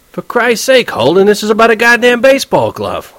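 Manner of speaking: high, loud, indignant roommate; sudden high, loud outrage; exasperated outburst. Acted. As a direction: explode at Holden over the glove essay. Roommate blows up over essay.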